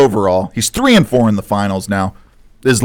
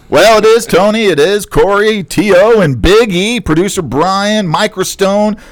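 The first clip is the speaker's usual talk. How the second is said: upbeat podcast opener, starting high and settling; show-host intro; 5 semitones higher than usual. Acted. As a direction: read the intro and sponsor plug like a radio host, drawing out the web address. Hosts the show intro and sponsor read.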